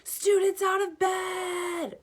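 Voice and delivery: high, slow shouting poltergeist; bellowing. A narrator's reading. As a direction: bellow it down the hall. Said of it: "Peeves bellowed".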